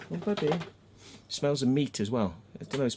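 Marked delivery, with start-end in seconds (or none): 0.1-0.5 high, cooing pet voice. Real, not acted. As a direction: coo fondly at a dog. Baby talk to his dog.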